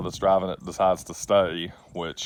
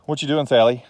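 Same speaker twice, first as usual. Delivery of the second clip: higher, louder cooing to the dog; 6 semitones higher, 5 dB louder than usual. Real, not acted. Baby talk to the dog.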